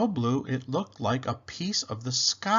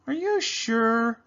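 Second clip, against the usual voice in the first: higher and slow, drawn-out question; gently doubtful parent; 10 semitones higher, slower than usual. Acted. Papa doubts Penny.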